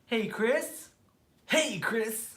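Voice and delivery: high, over-pronounced show greeting; question-reading voice. Shown as a demonstration. Host demonstrating his Hey Chris show, high reading voice. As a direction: put on your reading-a-question voice. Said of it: "that's my voice".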